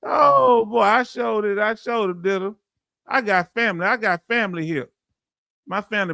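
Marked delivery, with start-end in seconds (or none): none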